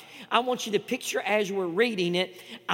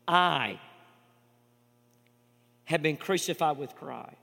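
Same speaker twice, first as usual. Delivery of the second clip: measured reading, pausing on each I; slow scripture reading; 2 semitones lower, slower than usual. Acted. Pastor reading Galatians aloud, slow and weighty. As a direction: read the verse deliberately, word by word. Reads the verse slowly, pausing.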